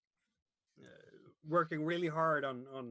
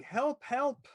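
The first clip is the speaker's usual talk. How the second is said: high, loud cry of alarm; acted distressed shout, raised pitch; screaming for help; 9 semitones higher, 6 dB louder than usual. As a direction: call out for help like someone hurt. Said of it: "hear them s-, ... screaming".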